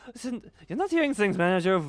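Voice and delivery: high, loud character voice; insistent rescuer. Acted. Rescuer assuring the manager they came, high excited voice. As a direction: insist brightly that help has arrived.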